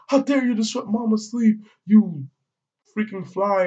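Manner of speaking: high-pitched indignant scolding, playfully overdone; mock outrage. Retelling how they attack the fly over mama's sleep, mock outrage. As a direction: scold like an offended guardian, hammed up.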